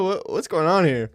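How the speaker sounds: usual speaking voice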